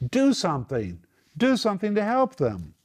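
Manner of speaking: high-pitched, insistent appeal; urgent plea. Acted. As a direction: urge them to act, pitch leaping up then falling. Acted plea against passive pity.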